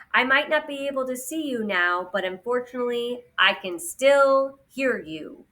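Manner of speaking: put-upon robot, stretching the last phrase; disapproving Guardian. Acted. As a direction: draw out the final words pointedly. Voices Guardian complaining.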